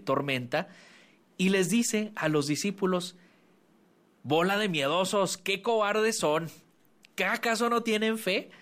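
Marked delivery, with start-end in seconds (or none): 4.2-8.5 scolding Jesus. Acted. Jesus rebukes the frightened disciples, mildly scolding.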